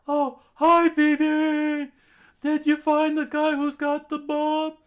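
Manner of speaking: very high, loud falsetto greeting; high-pitched shouted greeting; excited hero. Acted. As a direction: call out brightly in falsetto. Usopp greets Vivi excitedly.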